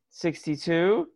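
high, uncertain call-out; mock terrified. Terrified woman in an apron calling tickets, mock fear. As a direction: call it high, as if scared to ask. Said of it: "some terrified woman in an apron".

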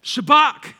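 Shabach called out loud and high, rising; loud Hebrew word. Shown as a demonstration. Pastor teaching the congregation the word, loud and high. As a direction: announce Shabach loudly. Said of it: "Here's the fifth word".

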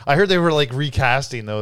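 normal talking voice

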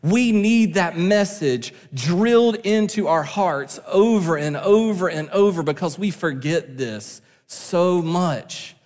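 ordinary talk

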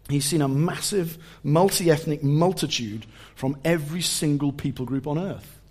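usual speaking voice

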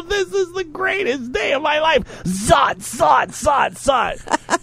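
very high, soft giddy zotting; wife zapping stickers, very high; giddy high glee. Retold or imitated. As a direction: gush like it's the best day ever. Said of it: "She's all".

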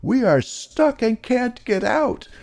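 very high, squeaky germ voice; crying germs. A narrator's reading. Cold germs tell Micro Pig they're trapped, tearful high squeak. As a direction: plead in a tiny, high-pitched squeal. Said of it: "they cried when they saw Micro Pig".

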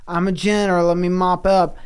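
high, loud cartoon janitor; goofy Grimace voice. Grimace announces himself to bus riders, loud and high. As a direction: bellow it like a dopey monster janitor.

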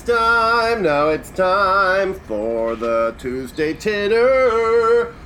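slow, drawn-out announcer voice; announcer intro. Acted. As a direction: announce the segment like a jingle, high and slow. Podcast segment intro jingle voice.

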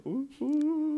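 high, wavering ghost moan; spooky ghost ooh. Pretend.

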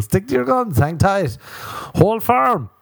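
high, exhorting slogans; rally the people with hammed-up conviction; mock rallying cry. Mock rallying speech to listeners.

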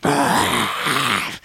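wordless growl imitating unintelligible metal singing; imitated core-metal vocals. Host showing lyrics you can't really hear, imitated metal growl. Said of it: "you know, it's just very, like".

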